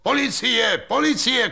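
very high-pitched cries; high, alarmed repeated call; shouting. A narrator's reading. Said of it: "křičel řemenář".

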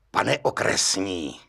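slow, understated vengeful address; vindictive. A narrator's reading. Gendarme putting his head in the door, vindictively. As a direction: draw out the title with a touch of spite. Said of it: "řekl mstivě".

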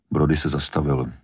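plain reading voice

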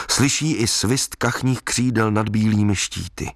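ordinary delivery

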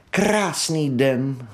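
slow, sighing Krásný den; contented sigh. Acted. Mole sighs happily in the boat, slow and contented. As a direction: breathe it out slowly, blissful. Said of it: "vzdychl si".

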